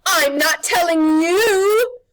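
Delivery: slow, high, loud crone; refuse, defiant and scared; witch refusing. Acted.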